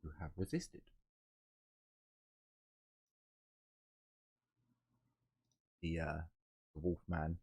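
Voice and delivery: quiet and distant, muffled. Real, not acted. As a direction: sounds far away and muffled. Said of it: "quiet and distant sounding".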